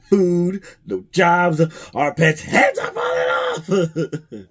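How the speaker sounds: mock complaint collapsing into giggles; laughing quote. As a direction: crack up while delivering the line. Retelling the movie quote, cracking up.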